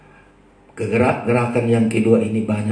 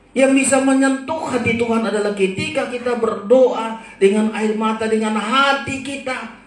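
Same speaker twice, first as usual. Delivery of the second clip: high, insistent sermon voice; fervent preaching; 10 semitones higher than usual. Real, not acted. Preacher teaching worshippers what touches Tuhan's heart, fervent preaching. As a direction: drive the appeal home, leaning on key words.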